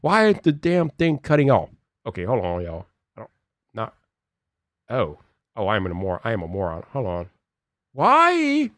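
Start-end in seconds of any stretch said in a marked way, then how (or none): 2.0-7.3 low, soft aside; quiet, low-pitched self-reproach; self-directed muttering. Real, not acted. Mutters to himself while fixing stream. 7.9-8.7 high, drawn-out frustration; high-pitched plaintive complaint; exasperated wail. Real, not acted. Frustrated by stream problem.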